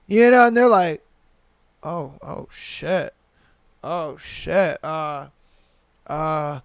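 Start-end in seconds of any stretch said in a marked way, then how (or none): 1.8-6.6 low, hushed "oh shit"; stunned. Retold or imitated. Imitating girls hearing the praise, stunned. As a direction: softly imitate someone flustered and lost for words. Acts out a stunned girl's reaction.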